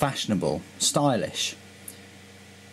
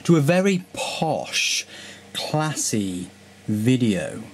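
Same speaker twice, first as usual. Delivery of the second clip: posh voice; slower than usual. Acted. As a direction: play it grand and plummy. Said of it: "enough with the posh voice".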